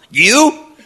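high, incredulous "You?"; scoffing disbelief. Acted. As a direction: laugh off the boy's offer. Brothers mock David's offer.

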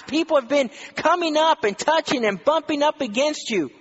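high, mock-exasperated disciple scoffing at Jesus; incredulous disciple. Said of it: "you say".

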